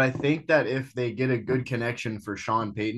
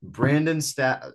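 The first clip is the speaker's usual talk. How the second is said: ordinary talk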